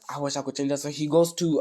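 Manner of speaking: ordinary delivery, nothing marked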